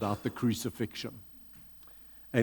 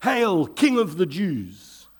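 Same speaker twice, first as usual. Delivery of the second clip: slow, louder, higher mock salute; mocking call; 5 semitones higher, 4 dB louder than usual. A narrator's reading. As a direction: jeer it out slowly to the prisoner. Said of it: "began to call out to him".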